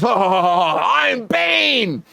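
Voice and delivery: slow hammed villain laugh into a high cry; Bane laugh. Tom Hardy gloating after throwing Moon, villain laugh. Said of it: "he goes".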